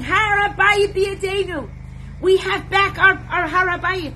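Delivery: high loud joyous cry of victory; soldiers screaming. Retold or imitated. Soldiers screaming their victory at the Temple Mount, ecstatic shout.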